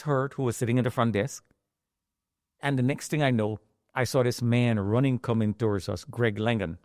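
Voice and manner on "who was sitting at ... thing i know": ordinary talk, nothing marked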